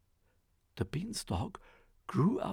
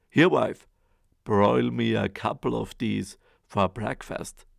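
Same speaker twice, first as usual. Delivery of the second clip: loud gruff command; booming ogre; 7 dB louder than usual. Acted. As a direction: bellow at the wife. Ogre orders his breakfast.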